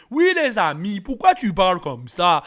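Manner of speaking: loud mock generic African accent; put-on African accent, hammed up; caricatured African accent. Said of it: "défini comme un accent africain".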